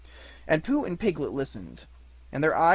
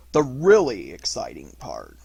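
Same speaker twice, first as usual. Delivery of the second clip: lower, heavy-hearted emphasis; most melancholy; 5 semitones lower, 5 dB louder than usual. A narrator's reading. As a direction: draw it out with deep gloom. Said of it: "in his most melancholy voice".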